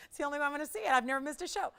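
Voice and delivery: retold indignant complaint; mad mom. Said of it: "She was mad".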